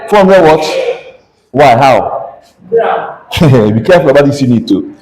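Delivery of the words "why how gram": ordinary talk, nothing marked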